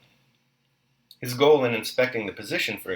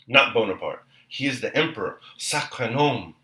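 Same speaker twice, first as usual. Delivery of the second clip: a touch of an indignant Frenchman, louder; angry; 3 semitones higher, 5 dB louder than usual. A narrator's reading. French grenadier correcting Dólokhov, mildly indignant. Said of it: "cried he angrily".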